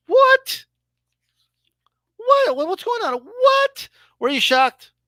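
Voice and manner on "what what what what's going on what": high squeaky stunned "What?"; mock disbelief. Fan reacting to positive test, mock shock. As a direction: play a fan who can't believe it.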